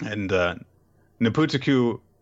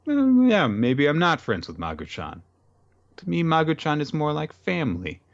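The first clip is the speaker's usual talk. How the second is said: put-on lightly raised, earnest girl voice; sincere girl; 7 semitones higher than usual. Girl telling the villain the demon is family, lightly voiced. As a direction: say it simply like a devoted girl. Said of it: "Ruru pauses ... and says".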